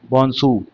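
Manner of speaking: "बोनसू" said alone, French style; model pronunciation. Shown as a demonstration. Teacher modelling French greeting for the class. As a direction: model "बोनसू" carefully, final r dropped. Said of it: "ऐसे ही कहा जाए बोनसू".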